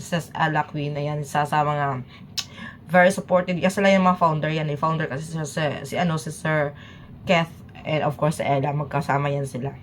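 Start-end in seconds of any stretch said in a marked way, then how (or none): none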